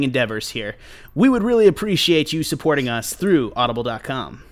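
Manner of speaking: measured, projected podcast ad delivery; sponsor ad read. Real, not acted. Podcast host to listeners about the sponsor, ad read.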